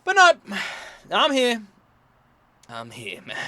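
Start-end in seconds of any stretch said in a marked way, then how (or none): none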